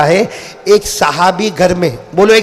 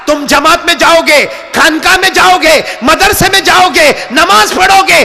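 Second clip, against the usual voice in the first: fiery preaching; 8 semitones higher than usual. Acted. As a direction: preach it with full force to the crowd. Preaching at full force. (जोश भरी ऊँची आवाज़ में तक़रीर)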